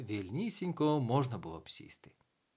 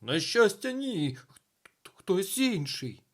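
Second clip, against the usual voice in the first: high, agitated answer with a stammer; loud relief breaking into hesitation; flustered; 7 semitones higher, 6 dB louder, slower than usual. A narrator's reading. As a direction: stumble over the word in panic. Panicked teacher answers, stammering.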